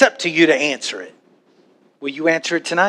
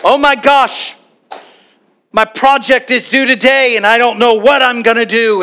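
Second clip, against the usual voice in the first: mock panic; 5 semitones higher than usual. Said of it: "I'm like".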